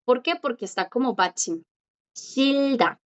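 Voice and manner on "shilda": Shilda drawn out with a clear L before da; teacher's stretched Shil-da, falling at the end. Shown as a demonstration. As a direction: model Shilda slowly, the R turned to L. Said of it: "aquí la R suena como L".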